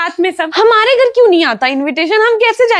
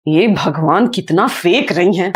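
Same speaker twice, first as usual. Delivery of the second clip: much lower-pitched aside in disbelief; exasperated aside; 11 semitones lower, slower than usual. Acted. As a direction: drop low, as if to yourself. Aside about the mother's boasting. (धीमे सुर में खीझ भरी टिप्पणी)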